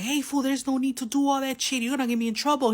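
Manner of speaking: high, pressing protest; retold urgent plea. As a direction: hold him back, worried. Retold talking homie out of fight.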